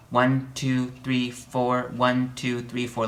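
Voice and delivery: even, mechanical count with no accent on any beat; robotic counting. Shown as a demonstration. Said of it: "like a robot".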